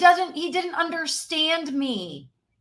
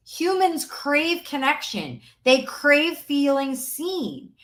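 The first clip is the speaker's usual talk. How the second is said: 1 slow emphasis; slower than usual. Real, not acted. Coach stressing key point.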